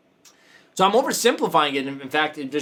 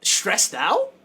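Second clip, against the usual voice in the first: incredulous question; 11 semitones higher, much slower than usual. Retold or imitated. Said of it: "But I'm like".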